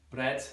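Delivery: "Bread" with Scouse rolled R. Shown as a demonstration. Teacher demonstrating Liverpool accent, "Bread" with rolled R. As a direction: trill the R in "Bread". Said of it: "Again, rolling the R's".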